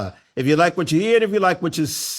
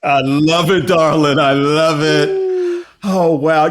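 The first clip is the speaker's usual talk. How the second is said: gushing host; 5 dB louder than usual. Acted. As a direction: gush over the guest. Host gushes over guest.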